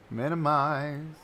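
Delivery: slow, stretched "Minimize"; playfully drawn out. Real, not acted. Streamer narrates closing a window, playfully drawn out. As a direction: stretch every syllable of the word.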